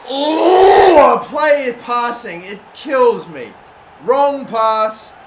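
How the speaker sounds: high, loud, slow wail of disbelief; anguished shout. Real, not acted. Gamer agonising over a misplaced pass, anguished shout. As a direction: cry out at the wrong pass.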